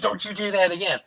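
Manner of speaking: high-pitched mock telling-off; retold scolding. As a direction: tell off a naughty cat, playfully. Retells scolding the cat.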